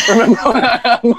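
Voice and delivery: high, words breaking into laughter; real laughter swallows the end of the line; laughing hard. Real, not acted. Cracking up at old footage.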